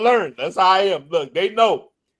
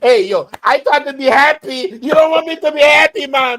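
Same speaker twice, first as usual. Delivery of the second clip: hammed-up Dominican English, pitched up and louder; lively mock Dominican accent; 6 semitones higher, 4 dB louder than usual. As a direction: play the homesick Dominican pleading to be happy. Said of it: "I start talking like that".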